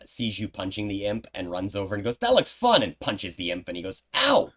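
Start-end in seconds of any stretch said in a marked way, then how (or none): 2.2-2.8 high, loud, gleeful character voice; eager Umbra. Acted. Umbra runs over to join the punching, eager. 4.2-4.5 high, loud imp complaint; pained, outraged imp. Acted. Game master's imp complains of little fists, pained outrage.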